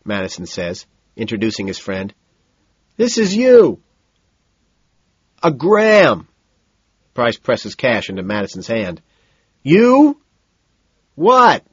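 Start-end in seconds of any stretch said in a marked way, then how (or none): 3.0-3.8 high, loud yell; hollered presentation; shouted introduction. A narrator's reading. 5.4-6.3 high, loud yell; urgent shout over noise; shouted order. A narrator's reading. Order repeated loudly in club. 9.6-11.6 high, loud questions; baffled holler; shouted confusion. A narrator's reading. Mishearing name in loud club.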